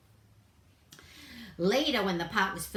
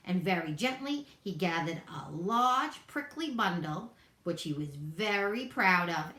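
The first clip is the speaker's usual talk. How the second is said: plain reading voice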